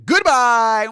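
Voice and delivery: high, loud, drawn-out farewell; big showy goodbye holler; shouted sign-off. Pretend. Host signing off to stream viewers, shouted farewell.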